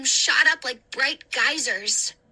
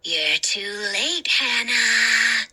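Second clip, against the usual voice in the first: low, slow mocking sneer; 3 semitones lower, much slower than usual. The ghost taunting Hannah, low slow sneer. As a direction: taunt her, savoring it. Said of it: "he sneered".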